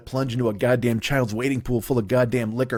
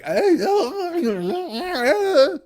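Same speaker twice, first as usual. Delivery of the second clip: high, strained, laughing screech; much slower than usual. Retold or imitated. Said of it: "That's what she sounds like".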